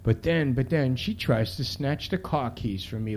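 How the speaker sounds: ordinary delivery, nothing marked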